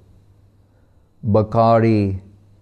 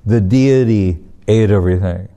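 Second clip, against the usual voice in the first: mock disbelief, leaning on DEITY; incredulous echo; 3 dB louder than usual. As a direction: repeat it back as a doubting father. Father echoes boy in disbelief.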